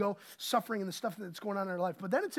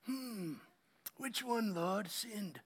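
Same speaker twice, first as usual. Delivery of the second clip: mock theological pondering; 4 semitones lower, 11 dB quieter, much slower than usual. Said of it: "asking the theological questions like".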